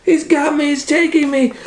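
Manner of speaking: very high squealing panic; high frightened cry. Acted. Rosie crying out as the cat carries her off. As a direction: cry it out in a tiny terrified voice.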